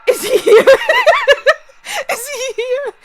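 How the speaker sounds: mock alarm cracking up; laughing panic. Real, not acted. Joking the waiter might be nearby, laughing.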